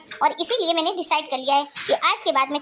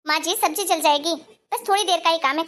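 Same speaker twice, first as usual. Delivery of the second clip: normal talking voice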